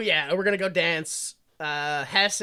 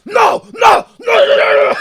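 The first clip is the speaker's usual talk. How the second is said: high, full-volume acted screaming; losing it; 13 dB louder, slower than usual. Larry hearing about the baby, screaming in hammed-up meltdown. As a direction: scream the refusal as if losing it completely. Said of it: "He just loses his shit".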